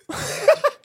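squealing high laugh; laughing at the punchline. Real, not acted. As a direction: burst into a high-pitched laugh. Laughing at own story.

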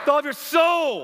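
high, loud proclaiming shout; shouted call to the congregation; with chutzpah. Real, not acted. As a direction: call it out with guts to the crowd. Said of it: "with some guts".

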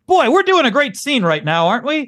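high, loud, self-satisfied stage aside; hammy stage actor. Retold or imitated. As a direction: mug to the audience like a smug actor mid-play. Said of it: "the actor in the middle is like".

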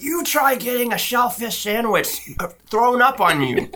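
high, slow, drawn-out retort; mock indignation. Co-host quotes him answering harassment at work, indignant. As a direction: imitate an aggrieved man protesting high. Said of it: "he's like".

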